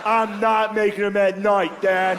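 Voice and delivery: high, slow protest, half laughing; a kid insisting to his dad, slowly; whiny kid denial. Retold or imitated. Kid denies it to dad, high and slow.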